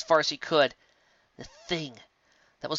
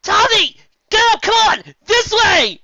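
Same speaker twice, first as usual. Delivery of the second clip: high, loud urgent yell; loud commanding shout; 15 dB louder, slower than usual. A narrator's reading. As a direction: yell to get her moving. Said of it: "Final yelled".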